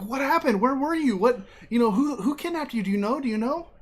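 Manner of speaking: high-pitched, mock-frantic barrage of questions; frantic questioning. Breeze questioning his returned partner, frantic. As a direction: fire off panicked questions at a friend.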